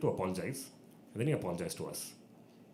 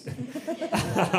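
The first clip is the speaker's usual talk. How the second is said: high burst of laughter; laughing; 12 semitones higher than usual. Real, not acted. Laughs at retold warning.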